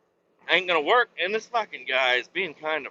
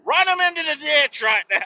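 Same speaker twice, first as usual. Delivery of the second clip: shouted, much higher and louder, genuinely pissed off; real furious rant; 11 semitones higher, 6 dB louder than usual. Real, not acted. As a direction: yell it out, truly fed up with the calls. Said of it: "I'm so pissed".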